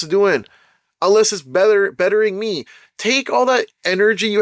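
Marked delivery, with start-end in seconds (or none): none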